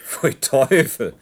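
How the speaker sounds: slow, loud, disgusted pfui; drawn-out loud disgust. Acted. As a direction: spit it out in revulsion. Disgust at flower tea.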